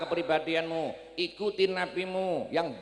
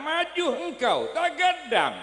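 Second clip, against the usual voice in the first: raised, higher call of the Prophet; calling forward; 7 semitones higher, 4 dB louder, slower than usual. Acted. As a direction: call him up with authority.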